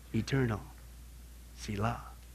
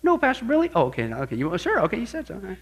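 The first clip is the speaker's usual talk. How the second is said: playing the skeptic; 6 dB quieter, faster than usual. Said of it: "you wanna play the skeptic".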